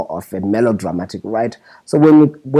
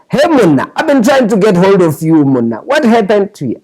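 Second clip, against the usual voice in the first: imitating Shona's Tswana accent, pitched much higher; a hammed-up higher Tswana-accented call; 9 semitones higher, 5 dB louder than usual. Shona phoning to wake Muna, in a mock Tswana accent. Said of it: "he does it with that whole Tswana accent".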